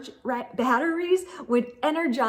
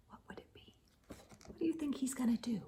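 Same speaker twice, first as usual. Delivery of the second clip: hushed suspense; whisper; 17 dB quieter, much faster than usual. Real, not acted. Reader whispering a guess to the children. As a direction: whisper it like a secret.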